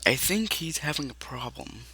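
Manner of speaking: soft aside, well under the voice; talking to himself. Acted. Freddy remarks to himself as they walk off, softly. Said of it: "they're just talking to themselves".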